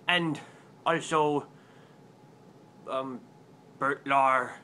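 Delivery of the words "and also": ordinary talk, nothing marked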